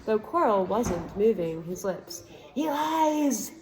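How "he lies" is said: high, eerie hidden voice accusing; a disembodied voice crying out, higher. A narrator's reading. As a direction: hidden master voice exposing the boy's lie. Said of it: "a high voice spoke".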